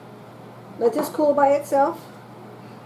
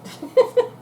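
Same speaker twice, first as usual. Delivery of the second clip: laughing; 12 semitones higher, 5 dB louder than usual. Real, not acted. Laughing at her sacrifice.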